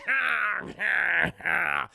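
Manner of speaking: loud, raspy drawn-out cackle; imitated gloating Penguin laugh; slow, loud evil laugh; villain laugh.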